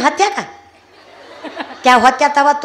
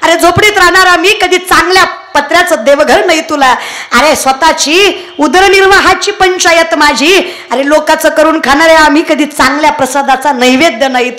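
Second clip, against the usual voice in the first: loud, higher impassioned plea; fervent prayer; 5 semitones higher, 6 dB louder than usual. Acted. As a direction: cry out to God. (विठ्ठलाला आर्त हाक)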